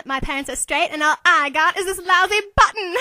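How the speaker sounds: joke punchline. Acted. Reading a joke button.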